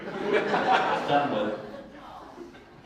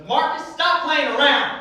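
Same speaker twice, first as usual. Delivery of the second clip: high, impatient woman's voice on the phone; a much higher mock scold, stressing stop; irritated caller telling Marcus to quit joking; 10 semitones higher than usual. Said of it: "She goes".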